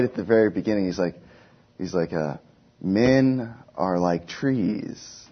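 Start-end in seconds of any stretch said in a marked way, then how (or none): 2.8-5.3 slow, drawn-out narrator voice; grand, very slow opening line; documentary narrator. Retold or imitated. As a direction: stretch it out like a documentary voiceover, pitched up. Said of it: "this man's voice, speaking".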